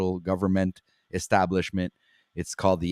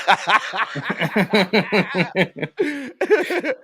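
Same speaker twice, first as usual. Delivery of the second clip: loud high laugh; 12 semitones higher, 4 dB louder than usual. Real, not acted. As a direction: burst of high cackling laughter. Host mocked about quitting weed.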